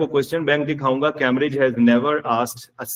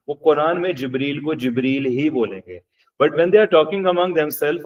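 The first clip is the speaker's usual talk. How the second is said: ordinary talk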